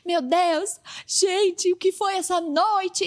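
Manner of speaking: mock gushing leaders. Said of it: "todo mundo".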